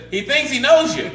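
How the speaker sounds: high, loud punchline yell; exasperated-husband style shout, played for laughs; shouting to deaf wife. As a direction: holler it for someone hard of hearing.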